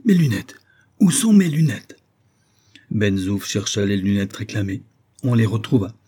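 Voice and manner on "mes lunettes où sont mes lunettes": high, loud outburst of temper; angry cry. A narrator's reading. Revived professor demands his glasses, angry cry. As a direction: cry out crossly, pitch jumping up and falling. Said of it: "comme s'il fût revenu à la vie par un accès de colère ... il s'écria".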